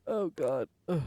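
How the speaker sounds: normal talking voice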